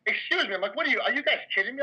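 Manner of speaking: a bouncer telling off rude guests, mock outraged; heated retelling. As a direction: retell the bouncer's disbelief with some heat. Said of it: "I might have been a little more heated telling you now".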